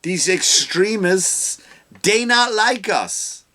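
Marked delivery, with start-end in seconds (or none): 2.0-3.4 much higher, slow mock imitation; high mocking parody of her line. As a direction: ham up her phrasing. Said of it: "she says about these".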